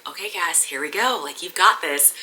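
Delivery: soft self-encouragement; quiet coaching to yourself; quiet self pep talk. Retold or imitated. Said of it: "I was like".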